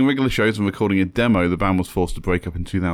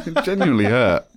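laughing. Real, not acted. Laughing after being teased.

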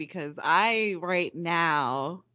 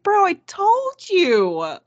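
exasperated; 12 semitones higher, 8 dB louder, much slower than usual. Retold or imitated. Retelling exasperated reply to friend.

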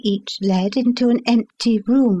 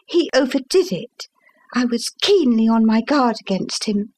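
ordinary delivery

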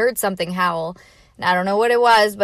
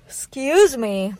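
yawning; 6 semitones higher, slower than usual. Real, not acted. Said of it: "I'm yawning".

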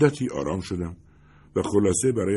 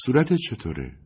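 worried; 5 semitones higher than usual. Acted. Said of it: "با نگرانی پرسید".

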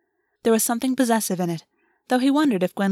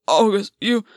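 louder, choked-off protest; after coughing; 4 dB louder than usual. Acted. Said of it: "and Gwen coughed".

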